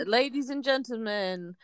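grand stage announcer intro. Pretend. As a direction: announce her like a showman. Announcing a comedian.

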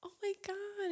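high, soft gasping horse voice; mock-swooning animal voice; voicing a horse. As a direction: play an awestruck horse, high and hushed. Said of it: "this horse is like".

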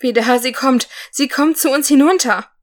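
dwarf warning the warrior that the fairy is coming, trembling. A narrator's reading. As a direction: warn your friend, voice trembling. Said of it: "sprach der Zwerg mit zittriger Stimme".

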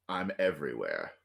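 low, quiet menacing whisper of evil; dark monster voice. Acted. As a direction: speak as an ancient evil skull.

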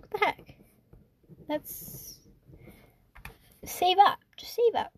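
high-pitched upset exclaim, pushing to stay calm; retelling her frustrated self, pitch climbing; mock exasperated high voice; upset outburst. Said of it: "I was super upset".

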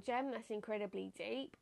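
ordinary talk, nothing marked

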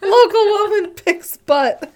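slow, loud, laughing headline read; headline announcer. Pretend. Mock newspaper headline.

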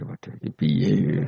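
slow, low dismayed mutter; a hammed-up "uh-oh, now what"; mock worried angel. Munkar Nakir at a loss before Umar, slow dismay.

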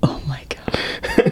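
breathless whisper of disbelief. Real, not acted. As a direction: hush it out in shock. Shocked reaction.

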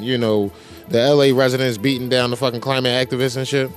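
ordinary talk

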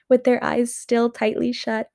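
ordinary delivery

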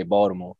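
"Baltimore" said as "Bawdamore", no T; T not pronounced. Shown as a demonstration. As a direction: demonstrate local "Baldamore". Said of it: "we don't pronounce the, uh, the T".

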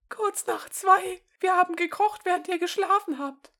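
put-on sweet old grandma. Grandma voiced in story.

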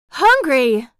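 high, loud exclamation of horror; horrified. A narrator's reading. Townspeople aghast at a hungry visitor, horrified. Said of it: "they murmured in a horrified chorus".

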